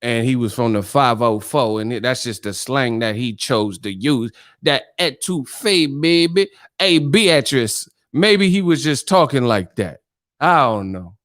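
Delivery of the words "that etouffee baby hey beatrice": slow, higher New Orleans drawl; playful New Orleans accent impression. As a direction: stretch it out like a New Orleans local. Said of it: "that's how they talk".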